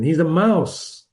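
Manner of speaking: ordinary delivery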